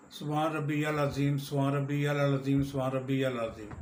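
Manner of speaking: quick repeated phrase with the words run together; rushed سبحان ربی العظیم; hurried recitation. Shown as a demonstration. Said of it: "رکوع میں پڑھتے ہیں".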